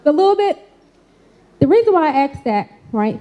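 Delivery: normal talking voice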